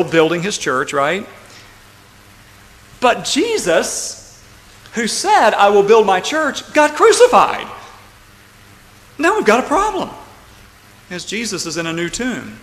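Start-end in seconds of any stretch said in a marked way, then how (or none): 3.0-10.1 high, loud and drawn-out pulpit delivery; dramatic preaching. Real, not acted. Preacher setting up the crisis of the crucifixion, dramatic preaching. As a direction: build to a ringing, high-pitched peak.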